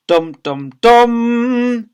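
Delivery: "dum, dum, DUM" with the last one high and long; loud suspense drumroll in the voice; dramatic tension sting. Shown as a demonstration. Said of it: "I just added that to ramp up the tension".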